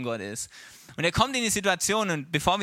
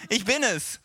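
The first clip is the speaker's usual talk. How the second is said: insistent healed man; 6 semitones higher than usual. Acted. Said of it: "Mitte und sagt".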